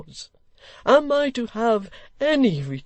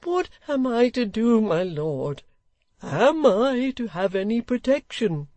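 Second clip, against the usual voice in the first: put-on slow, high, exasperated old man; choleric complaint; 10 semitones higher, 6 dB louder, much slower than usual. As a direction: vent his grievance, drawn out.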